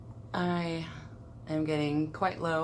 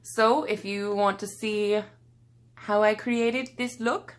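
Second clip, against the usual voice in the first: bright, high, loud YouTuber intro voice with a stray accent; over-the-top channel intro in an accidental accent; odd intro accent; 4 semitones higher, 6 dB louder than usual. Real, not acted. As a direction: greet the viewers up high with a slipped-in accent. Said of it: "I don't know what I did with that accent there".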